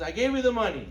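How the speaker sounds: high, loud exclamation; emphatic acted insistence. Father telling son he gave the money, loudly insistent. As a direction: play an exasperated father insisting.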